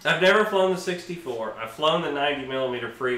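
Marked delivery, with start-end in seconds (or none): none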